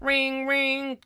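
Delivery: high, held ringing tone; trilled phone imitation; telephone ring.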